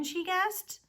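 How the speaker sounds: usual speaking voice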